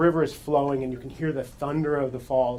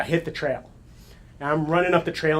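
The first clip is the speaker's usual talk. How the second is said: ordinary talk, nothing marked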